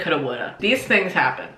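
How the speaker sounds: ordinary delivery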